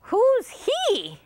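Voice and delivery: high chimp voice; chimpanzee voice. Acted. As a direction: hoot it like a chimp. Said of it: "asked the chimpanzee".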